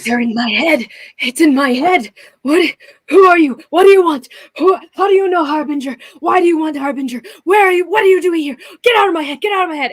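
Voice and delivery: high, loud, frantic yelling; a creature screaming to get out of his head; panicked shouting. Acted. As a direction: shout at the voice in your head in terror.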